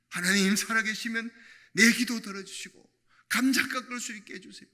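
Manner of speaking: a retold tearful prayer, rising to loud begging; pleading prayer. Wife begging God in her kitchen, rising tearful plea.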